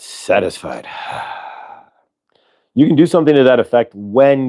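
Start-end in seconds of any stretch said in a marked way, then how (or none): 0.0-1.0 Satisfied breathed out softly on a long falling sigh; sighed word. Shown as a demonstration. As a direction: let Satisfied melt out on the exhale. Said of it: "we do it with a sigh".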